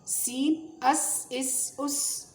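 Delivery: اس with a thin hissing whistle; whistled s. Shown as a demonstration. Teacher drilling the whistling سین. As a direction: hiss the س out sharp. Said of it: "سیٹی کی طرح آواز نکالیں".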